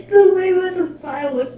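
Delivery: high, loud, talking through laughter; cracking up mid-sentence; real laughing. Real, not acted. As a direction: barely get it out for laughing. Laughing at Fai's reaction.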